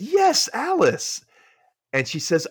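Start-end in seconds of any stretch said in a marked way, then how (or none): 0.0-1.2 high, slow, drawn-out eager yes; eager agreeing. Acted. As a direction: stretch the yes, overeager. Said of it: "And he says".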